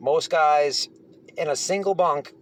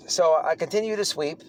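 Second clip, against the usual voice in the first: ordinary talk, nothing marked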